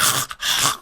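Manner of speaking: throaty hawking noise, no voice; imitated loogie hawking. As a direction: hack like spitting a loogie. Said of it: "either someone spitting a loogie".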